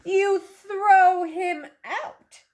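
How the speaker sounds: usual speaking voice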